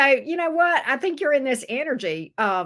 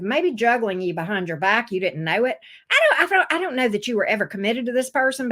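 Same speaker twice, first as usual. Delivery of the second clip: usual speaking voice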